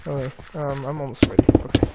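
normal talking voice

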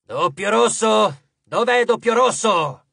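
loud, drawn-out call to a crowd; shouting. A narrator's reading. Titan calling the next group for the lift, shouting. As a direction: call out the number over a milling horde. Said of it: "e gridò".

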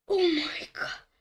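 rattled after a scare; shaken gasp. Real, not acted. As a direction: breathe it out shaken. After jumpscare.

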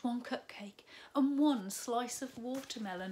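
lively storybook read-aloud; bright children's story narration. A narrator's reading. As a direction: tell it like a bedtime story to little ones. Reads picture book to children.

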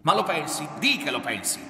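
loud, high, pressing challenge; forceful, heated accusation; angry insistence. A narrator's reading. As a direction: hound them for an admission. Son presses her to admit it.

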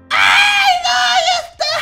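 high loud shriek of fright; startled yell, half laughing; panicked scream. Acted. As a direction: scream as it jumps out. Monster appears behind her.

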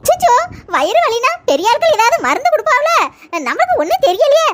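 a giggly, helpless offer; chuckling. Acted. Mother-in-law laughs while advising the wife to phone her mother.

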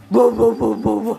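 loud short woofs; imitated barking. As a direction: show how the dog barks. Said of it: "Gimana dia gonggong?".

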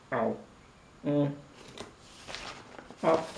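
talking through a mouthful of chocolate; words mushed by a full mouth. Real, not acted. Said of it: "Chocolate is melting".

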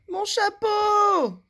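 high, slow, loud wail; cry. Acted. Monsieur Malpoli wailing over his crushed hat, loud and high. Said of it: "cria Monsieur Malpoli".

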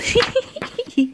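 high tittering giggle. A narrator's reading. Trina laughs at the small giant, high giggle. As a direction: giggle like a little girl, high-pitched. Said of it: "And Trina giggled".

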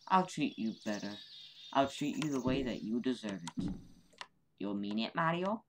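normal talking voice

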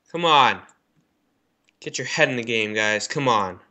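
ordinary talk, nothing marked

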